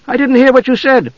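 put-on high-pitched childlike insistence; loud nosy complaint. A narrator's reading. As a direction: pester them at high pitch. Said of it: "Tasselhoff asked loudly".

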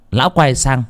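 ordinary delivery, nothing marked

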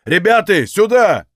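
high, loud call for backup; shout. A narrator's reading. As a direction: call your mates over urgently. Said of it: "крикнул". (громкий окрик)

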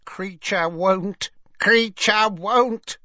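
high, drawn-out croak, rising and building; croaking house elf. A narrator's reading. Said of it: "croaked the house elf".

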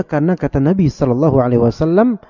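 normal talking voice

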